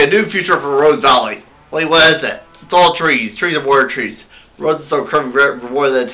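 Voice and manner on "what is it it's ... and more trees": normal talking voice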